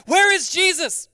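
high, loud panicked call; frantic shout. Acted. Disciples in the storm shouting in panic. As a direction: call out in alarm for Jesus.

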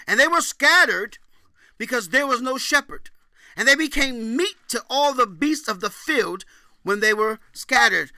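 loud, high preached scripture; scripture reading. Acted. Preacher booming scripture to the congregation. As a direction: proclaim the verses like a prophet.

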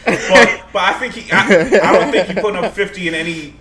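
loud, laughing through words; cracking up while arguing. Real, not acted. Laughing during friendly debate.

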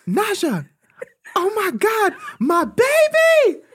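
put-on panicked mother wailing over her child; frantic mother. Friend imitates the mother wailing over her fallen daughter. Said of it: "I hear her mother".

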